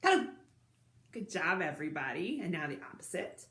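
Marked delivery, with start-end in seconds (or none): 0.0-0.3 super-quick, tiny hello; as quick as possible. Shown as a demonstration. Showing children a hello said quickly, very fast. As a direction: rattle it out in a split second. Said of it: "quickly as we can".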